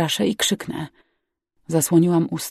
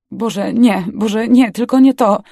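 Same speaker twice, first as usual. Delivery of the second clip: rising, louder desperate plea; panicked pleading; 4 semitones higher, 7 dB louder, slower than usual. A narrator's reading. Girl dreads dad's silence after crash, panicked plea. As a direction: build in dread, higher and louder.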